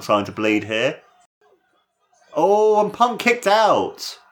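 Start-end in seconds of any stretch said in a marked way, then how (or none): 2.3-4.2 high, slow amazed call; excited call. Real, not acted. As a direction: stretch out the kick out.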